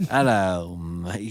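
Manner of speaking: loud Cockney greeting; mock a London bloke; British accent.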